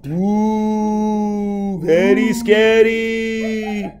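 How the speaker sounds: slow, drawn-out spooky ghost voice; hammed-up cartoon ghost. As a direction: moan it out like a Scooby-Doo ghost. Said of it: "That's the ghost voice".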